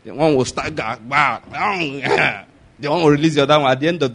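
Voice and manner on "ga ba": mimicked juju duel noises, "ga, ba"; staged sorcerer sound effects; imitated incantation blast. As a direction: act out spell-blasts with your mouth.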